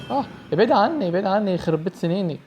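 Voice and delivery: loud high shouts to get away; panicked yelling. Real, not acted. Player shouting at Slendrina to stay away. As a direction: yell at her to back off. Fleeing monster in horror game.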